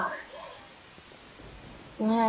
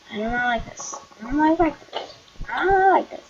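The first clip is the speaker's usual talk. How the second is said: rising panic; 3 semitones higher than usual. Real, not acted. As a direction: let the fear build line by line. Fear rising to outburst.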